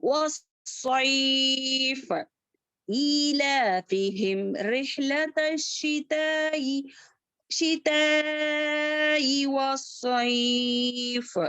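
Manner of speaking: very slow, with every madd held long; Quran recitation. Shown as a demonstration. Teacher recites the full verse slowly for students. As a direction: recite the whole phrase, stretching each vowel. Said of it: "मोटा बनाना चाहिए इसको".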